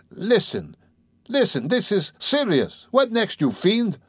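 high, agitated pleading; overwhelmed, begging to be taken seriously; horrified protest. Acted. Razumihin begging his teaser to stop, overwhelmed with horror. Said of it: "utterly overwhelmed, turning cold with horror".

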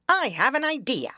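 bright, loud eager outburst; sudden excited cry. Acted. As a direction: blurt out a sudden idea. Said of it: "he cried suddenly".

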